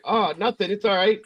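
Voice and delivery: high-pitched, unconvincing cover-up; feigned nonchalance. Retold or imitated. Dad covers up after scaring his son, feigned nonchalance.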